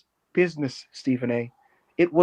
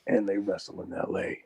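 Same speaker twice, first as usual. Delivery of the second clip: much lower, softer pundit voice; low mock sports-host croon; Stephen A aside; 10 semitones lower, 6 dB quieter than usual. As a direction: drop it low and sly like a TV pundit. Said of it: "he's like".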